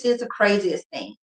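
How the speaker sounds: ordinary talk, nothing marked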